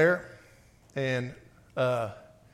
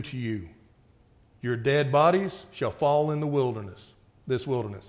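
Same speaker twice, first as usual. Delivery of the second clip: scripture reading; 5 semitones lower than usual. Acted. Preacher reading God's judgment to the congregation, grave reading voice.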